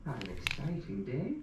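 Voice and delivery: put-on an old Irish widow cackling gossip. Old widow teasing the narrator about church, lilting high.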